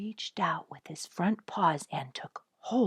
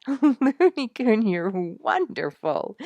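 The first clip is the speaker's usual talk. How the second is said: high, loud and slow, out of breath from laughing; breathless cry after laughing at the raccoon; breathless delight; 6 semitones higher, 7 dB louder, slower than usual. A narrator's reading. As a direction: gasp it out, still laughing. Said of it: "Ginny cried when she could get sufficient breath".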